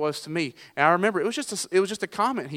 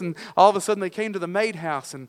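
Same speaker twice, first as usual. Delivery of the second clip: ordinary talk, nothing marked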